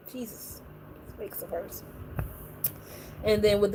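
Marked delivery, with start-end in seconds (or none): 0.1-1.8 much softer exasperated mutter; quiet exasperated aside. Real, not acted. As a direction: say it under your breath.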